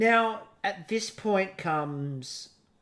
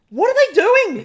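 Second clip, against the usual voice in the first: much higher, louder disbelief; exasperated what-are-they-doing; baffled production; 12 semitones higher, 9 dB louder, faster than usual. Retold or imitated. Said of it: "hear production going".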